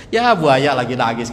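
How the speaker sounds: teasing line said through a laugh; laughing wife quote. Retold or imitated. Preacher retells the wife's teasing, laughing. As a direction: laugh while mocking him.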